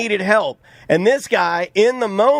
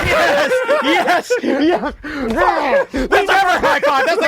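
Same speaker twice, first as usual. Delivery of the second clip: excited; 8 semitones higher, much slower than usual. Real, not acted. Celebrating a correct guess.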